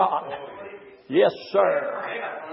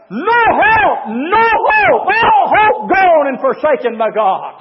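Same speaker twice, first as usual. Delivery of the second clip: preaching climax; 5 semitones higher than usual. Acted. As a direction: hammer the hopelessness home.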